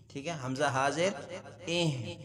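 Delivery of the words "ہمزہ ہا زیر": usual speaking voice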